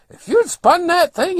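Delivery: high, loud, slow holler; imitating the old fellow's yelling; retold yell. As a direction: scold loudly from across the way. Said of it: "he's yelling over at me".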